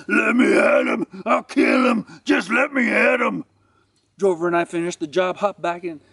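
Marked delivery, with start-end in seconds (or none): 0.1-3.5 high, put-on roar of rage; roaring dog. Berserk boxer straining at his chain, roaring. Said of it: "He fought against the chain and roared".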